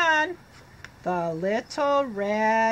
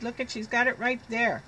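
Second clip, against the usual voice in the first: ordinary delivery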